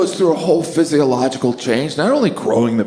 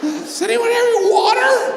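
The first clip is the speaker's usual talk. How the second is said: high, shouted, spent from screaming; hoarse passenger after screaming. Retold or imitated. Passenger worn out from a long dying scream, shouting high. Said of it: "I didn't really pace out my dying scream".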